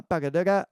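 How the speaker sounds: quick mouth-drummed lick; vocalized drum fill. Shown as a demonstration. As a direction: punch out the rhythm as "bagadaga".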